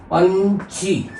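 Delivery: very slow "पंछी", split as पञ, छी; nasal ञ demonstration. Shown as a demonstration. Teacher saying it as written, "पंछी" slowly split. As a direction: hold the nasal in "पंछी", then release छी. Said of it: "उच्चारण करेंगे और लिखेंगे भी ऐसे ही".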